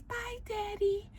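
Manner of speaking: put-on tiny, very soft high child voice; high, hushed little-girl squeak. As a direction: imitate a small child saying a slow goodbye. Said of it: "And the girl says".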